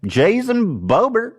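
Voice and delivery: high, drawn-out disbelief; incredulous. Real, not acted. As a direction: stretch the name out in disbelief. Repeating name incredulously.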